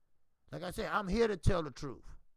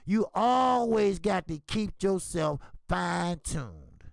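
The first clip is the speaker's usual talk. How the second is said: emphatic; 8 dB louder, slower than usual. Real, not acted. Preaching lesson with emphasis.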